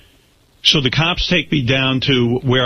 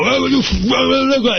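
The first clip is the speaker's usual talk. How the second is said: mock garbled rant, pitched way up; imitated cursing; 14 semitones higher than usual. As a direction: imitate a ranting man swearing unintelligibly. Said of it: "it's cursing me out".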